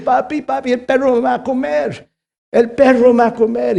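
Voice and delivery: put-on a frightened boy begging his dad; scared child. Boy tells father the dog will eat him, childlike fear.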